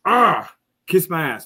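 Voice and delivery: slow, dragged-out scorn; slow defiant scorn. Acted. Host tells Twitter haters off, slow and scornful. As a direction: spit it out slowly at the haters.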